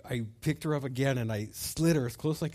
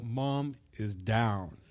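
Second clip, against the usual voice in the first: grave report; 5 semitones lower, 4 dB quieter, much slower than usual. Retold or imitated. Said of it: "I go".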